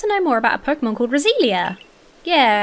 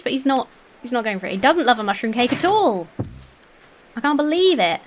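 normal talking voice

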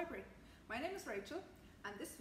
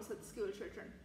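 usual speaking voice

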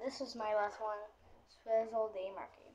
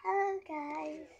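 character voice; 9 semitones higher, slower than usual. Pretend. Trying out a doll's voice.

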